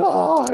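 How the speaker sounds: high, loud whiny "Oh"; hammed-up baby whine; whiny groan. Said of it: "and I'm like".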